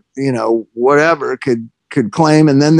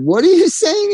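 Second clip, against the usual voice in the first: much higher baffled question; puzzled; 13 semitones higher than usual. Retold or imitated. Rob asks the clerk what he means, baffled and high. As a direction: squeak it out, completely lost. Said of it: "And Rob said".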